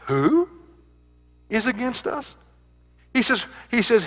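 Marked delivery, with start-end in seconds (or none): 0.1-2.4 "Who" leaned on, then a pause before "is against us"; stress on who. Shown as a demonstration. Preacher pressing the congregation with stress on "Who". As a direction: punch "Who" hard and let it hang. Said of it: "the emphasis here is on the word who".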